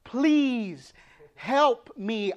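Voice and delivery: an earnest plea, not full crying; desperate pleading. Shown as a demonstration. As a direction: beg like a mother out of options.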